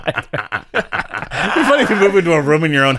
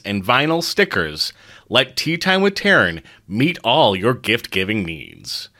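measured sponsor read, slowing to the end; 4 semitones lower, 3 dB quieter than usual. Acted. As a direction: read it like a friendly podcast ad, easing off the pace. Sponsor read at show start.

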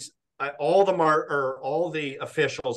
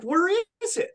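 much higher, frantic squeak; retold panic. Still hunting the missing wedge: frantic high-pitched question. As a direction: let the voice fly up in mock panic. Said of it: "I'm just panicking".

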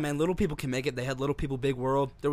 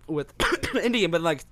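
ordinary talk

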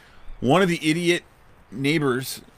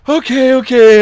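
put-on high, loud child's okay okay; a little girl giving in; child's tearful okay; 10 semitones higher, 10 dB louder, slower than usual. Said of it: "And she's like".

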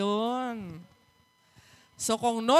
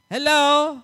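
loud, higher teasing call to the crowd; 4 semitones higher, 8 dB louder than usual. Real, not acted. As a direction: wake up the congregation. Calling out to unresponsive congregation. (malakas na tawag sa kongregasyon)

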